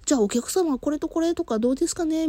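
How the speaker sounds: high, sweet clerk voice; polite shop clerk. Retold or imitated. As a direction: voice a cheerful young clerk. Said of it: "みたいな感じの風にね".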